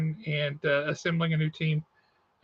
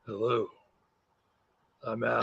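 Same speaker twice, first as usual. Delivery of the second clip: deep, quieter Batman-style parody; mock lowered voice; 7 semitones lower, 5 dB quieter than usual. Co-host teasing a congested friend, mock deep voice. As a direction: tease his co-host with a low voice. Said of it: "I'm not trying to lower my voice".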